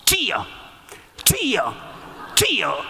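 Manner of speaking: each 주여 cut off short and sharp; staccato cries of 주여; chopped shouts. Retold or imitated. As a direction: clip every 주여 into its own burst. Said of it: "이걸 끊어 가십니다".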